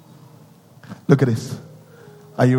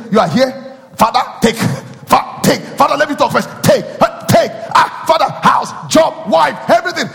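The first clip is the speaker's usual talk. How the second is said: eager pleading prayer; 11 semitones higher than usual. Retold or imitated. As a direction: pray like you can't wait to receive.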